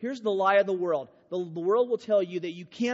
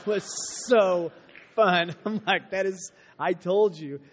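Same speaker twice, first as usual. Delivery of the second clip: louder, laughing through the words; laughing with glee; 5 dB louder than usual. Real, not acted. Laughing at Ian for slicing his thumb, gleeful.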